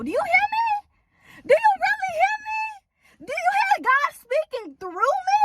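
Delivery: fervent high preaching cry; preaching shout. Real, not acted. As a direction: call out to them like a preacher at the peak. Preaching climax to viewers.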